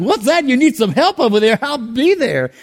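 hammed-up hero ready to help; mock superhero. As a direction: play the eager caped hero answering a call. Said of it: "I thought I was a superhero".

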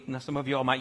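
ordinary delivery

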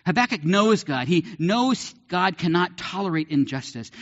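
usual speaking voice

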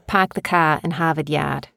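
Boston accent with dropped r's. Shown as a demonstration. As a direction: say it like a Boston local. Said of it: "can you do a Boston accent?".

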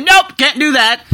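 high, loud and slow nope; firm refusal. Retold or imitated. Vlogger refusing a pricey ticket, loud and firm. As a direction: shut the idea down flat out.

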